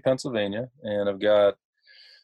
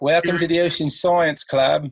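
higher, put-on Aussie accent; Australian accent; 6 semitones higher than usual. American student welcoming club recruits in a fake Australian accent. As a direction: greet them in a mock Australian accent. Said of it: "in my best, uh, attempt of an, an accent".

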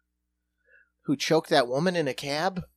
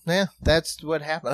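usual speaking voice

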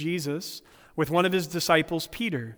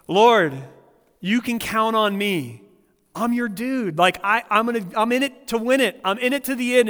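loud, higher, swaggering dude voice; brash Peter; 5 semitones higher, 5 dB louder than usual. Retold or imitated. Peter boasting to Jesus that he is in it to the end, loud swaggering bro. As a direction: play Peter as an overeager bro. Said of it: "So Peter's like".

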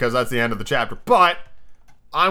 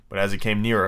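plain reading voice

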